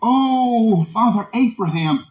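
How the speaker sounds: high, drawn-out preacher's cry. Acted. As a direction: call out in desperate appeal. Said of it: "He cried out".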